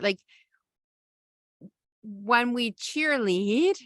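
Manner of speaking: normal talking voice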